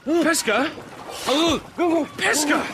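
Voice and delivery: high, loud anxious calls for a missing friend; alarmed calling. Acted. Swimmer searching for vanished Pesca, loud frightened calls. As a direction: call out his name in rising panic.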